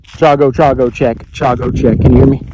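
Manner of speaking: loud, carrying radio call to a distant friend; calling over radio. Real, not acted. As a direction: project it into the walkie-talkie.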